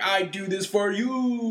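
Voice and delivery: high, drawn-out mock villain proclamation; slow pandering declaration in a supervillain voice; Bane. Parodying a pandering wrestler to the co-host, Bane impression. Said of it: "Full on Bane status".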